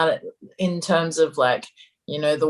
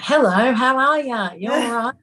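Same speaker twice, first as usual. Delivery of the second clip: higher, louder, exaggerated Aussie greeting; a phone hello in a put-on Australian accent; broad Australian accent; 5 semitones higher, 3 dB louder than usual. Shown as a demonstration. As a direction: lay the Australian accent on thick. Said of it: "you can hear me like".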